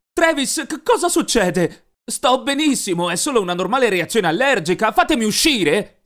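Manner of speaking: high, loud panicky pleading; frightened pleading. Acted. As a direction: beg to be let go in panic. Captive pleads with captor.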